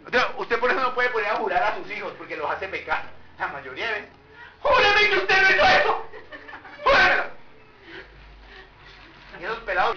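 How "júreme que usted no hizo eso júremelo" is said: high, loud shouted order; parent's demand. Acted. Parent demanding a child swear, high shout.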